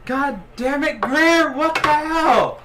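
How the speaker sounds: high, loud outraged shout; exasperated yell. Acted. Player's character to Greer after being hit, outraged high yell. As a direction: yell it high, drawing out the last words.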